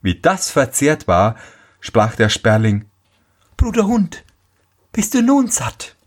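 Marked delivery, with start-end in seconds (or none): 3.6-5.9 high-pitched little sparrow. A narrator's reading. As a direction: ask in a high bird-character voice. Sparrow speaks to the dog. (hohe Spatzenstimme)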